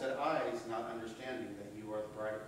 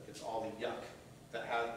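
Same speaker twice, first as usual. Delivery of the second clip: usual speaking voice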